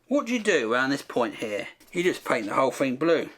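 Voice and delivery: normal talking voice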